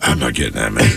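very low, lazy refusal; low paranoid mumble; low stoned refusal. Retold or imitated. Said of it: "I'm like".

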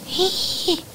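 high, sharp intake of breath; sudden audible gasp of surprise; astonished gasp. Retold or imitated. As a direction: gasp as if retelling a shock. Acted astonishment at friend's revelation.

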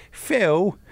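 British "Phil"; British accent. Retold or imitated. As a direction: say "Phil" like a Brit. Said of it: "who's British".

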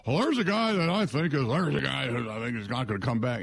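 a quick parody of a folksy broadcaster; John Madden. Retold or imitated. Host mimics a TV commentator calling a retirement, lightly voiced. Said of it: "And John Madden's like".

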